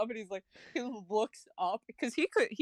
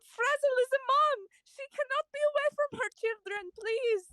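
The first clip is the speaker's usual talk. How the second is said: much higher, fast frantic begging; panicked pleading farmer; 13 semitones higher than usual. Acted. The terrified farmer pleads to keep his pig. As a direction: beg in a high squeal of panic. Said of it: "He screams. And he says".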